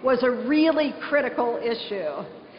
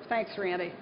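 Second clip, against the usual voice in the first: ordinary talk, nothing marked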